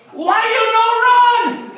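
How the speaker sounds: put-on high, drawn-out yell in caricatured Chinese accent; yelled mock Chinese accent. Chinese man yelling at fallen comic, high mock Chinese accent. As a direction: imitate an in-your-face scolding in mock Chinese accent. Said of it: "this little Chinese man is in my face, and he yells".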